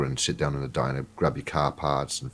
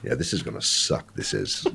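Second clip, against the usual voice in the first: normal talking voice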